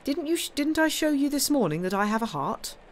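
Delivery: high, eager protest; eager Morrel. A narrator's reading. Morrel reminding the Count he has a heart, eager and high. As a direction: insist warmly, voice lifted.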